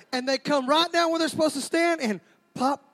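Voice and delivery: ordinary talk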